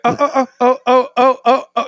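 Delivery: high, repeated stuttered oh's; mock soundboard drop. Shown as a demonstration. Host pitches a name drop to his brother, mock stutter. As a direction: demo a stuttering jingle on the co-host's name. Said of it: "we should create drops".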